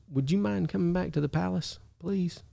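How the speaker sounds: soft, meek request; real quiet. Retold or imitated. Captain asking the prophet to come along, softly. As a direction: ask it gently and timidly. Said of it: "So he's real quiet".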